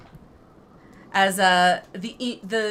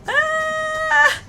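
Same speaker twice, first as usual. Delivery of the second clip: terrified screaming; much slower than usual. Real, not acted. Fleeing the monster.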